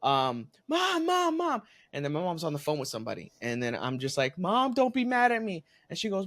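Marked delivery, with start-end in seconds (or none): 0.7-1.6 high, urgent calling out; little sister calling mom, high and urgent. Retold or imitated. 4.4-5.6 put-on kid. Kid confessing to mom.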